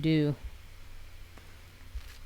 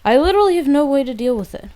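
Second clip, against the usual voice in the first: high, loud and fast complaint; exasperated shout; 6 semitones higher, 9 dB louder, much faster than usual. Real, not acted. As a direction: blurt it out in frustration. Frustrated exclamation mid-battle.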